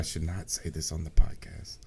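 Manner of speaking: low, hushed mock-secret aside; mock confiding whisper. Teacher confessing what he tells students on a podcast, pretend whisper. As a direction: drop your voice as if keeping it off the record. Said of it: "I'm gonna whisper it".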